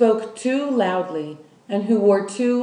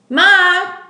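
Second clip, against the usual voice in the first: loud, much higher call; shouting; 11 semitones higher, 11 dB louder than usual. Acted. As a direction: yell across the house for her mother. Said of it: "Lolly shouted".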